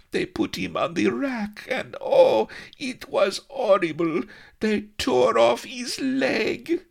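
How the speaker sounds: high, loud, shuddering horror; horrified. Acted. Melliflua shuddering as she tells Avery of the rack. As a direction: wail it out in distress, slowing at the end. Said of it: "shuddered piteously".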